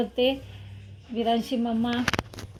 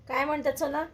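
usual speaking voice

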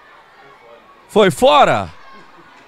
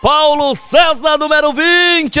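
explosive goal commentary. Real, not acted. Goal scored live commentary.

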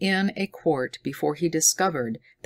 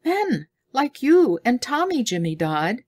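excited; 9 semitones higher, 4 dB louder than usual. A narrator's reading. Girl excited.

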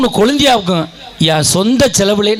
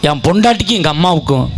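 ordinary talk

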